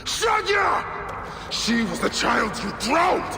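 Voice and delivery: slow, loud, accusing shout; furious shout. Acted. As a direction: hurl it at her killer. Bayek confronts his daughter's killer.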